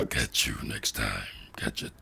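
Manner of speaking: very low, menacing growl; unhinged villain. Shown as a demonstration. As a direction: drop the voice way down. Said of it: "didn't even need to use autotune".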